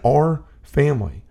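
"Our" slow and weighted, "family" after it; stress on our. Retold or imitated. Repeating the priest's words to the hosts, lingering on "Our". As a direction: lean on the word "Our" in "Our family". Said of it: "that really hit me right then".

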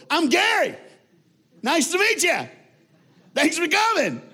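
high, loud overjoyed welcome; way too happy. Retold or imitated. Gary welcoming the killer's family at his door, overjoyed and loud. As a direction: greet them like long-lost friends, shouting with delight. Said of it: "the guy was way too happy".

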